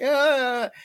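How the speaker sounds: high, short groan of pain; imitated pained cry. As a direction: voice the hurt buddy's wail. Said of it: "He's like".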